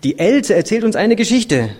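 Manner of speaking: put-on lifted, loud storybook child voice; child calling back. A narrator's reading. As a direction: call up to mother as a child. Children call back up to mother.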